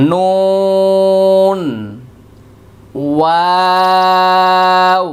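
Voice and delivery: নুন and ওয়াও held long with the lips rounded; slow rounded-lip letter demo. Shown as a demonstration. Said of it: "ঠোঁট গোল করে উচ্চারণ করতে হবে".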